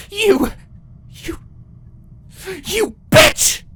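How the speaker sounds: enraged queen sputtering a curse; furious. Acted. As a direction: choke on rage then spit the insult. Twilight enraged, stammering insult.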